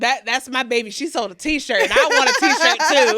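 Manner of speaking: imitating a beaming proud father; proud dad. Guest voicing her proud dad over a sale. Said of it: "he'd be like".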